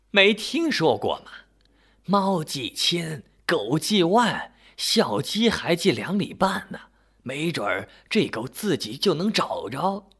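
put-on high-pitched, knowing old woman; old woman schooling her husband; old wife's voice. A narrator's reading. Old wife recites a saying.

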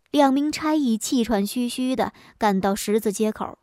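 usual speaking voice

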